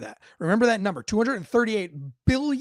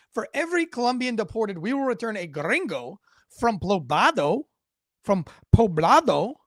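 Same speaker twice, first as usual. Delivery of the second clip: put-on Colombian accent; 4 semitones higher, 7 dB louder than usual. Host mimicking Miss Petro's post for viewers, exaggerated Colombian accent. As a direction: playfully put on her Colombian accent, stretching the place name. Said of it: "I'm using her accent to make it fun for you".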